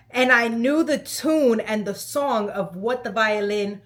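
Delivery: normal talking voice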